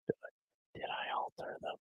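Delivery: breathy whisper. Pretend. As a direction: whisper the question. Playful mic test whisper.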